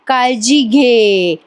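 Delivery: काळजी घे drawn out very slowly, each word savoured; moved, slowed quote. Retold or imitated. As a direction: repeat काळजी घे slowly, touched by it. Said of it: "असं बोललात ना?".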